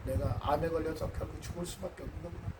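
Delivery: quiet resignation. Retold or imitated. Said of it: "받아들인다는 것이죠".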